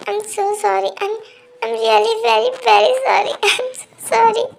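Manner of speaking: acted weeping, breaking down on sorry; crying apology. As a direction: sob out repeated apologies. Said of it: "तुम इतना क्यों रो रहे हो?".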